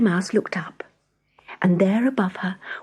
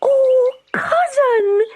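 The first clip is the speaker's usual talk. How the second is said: high squeaky mouse voice, louder; 5 dB louder, much slower than usual. A narrator's reading. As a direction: squeak it out in delight. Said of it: "she squeaked".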